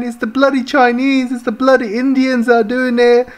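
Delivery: mocking a tearful official blaming others; sneering mock sob-story voice; mock crying politician. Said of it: "he was crying, he was crying at the end. He was saying".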